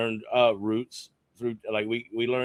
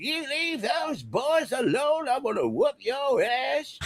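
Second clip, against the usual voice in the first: put-on high, slow scolding old-lady voice; a shrill granny shooing off bullies; mock old neighbour yelling from the porch; slower than usual. Crazy old people shouting at chasers.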